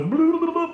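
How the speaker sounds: high warbling trap noise; imitated sound effect. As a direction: mimic a ghost trap firing up. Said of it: "with all those sound effects included".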